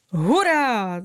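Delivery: a bright, lifted cry of joy; excited cheer. A narrator's reading. Clover cheering the fairy on, excited cry. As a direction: cheer out like a happy flower. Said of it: "zvolal nadšeně růžový jetel".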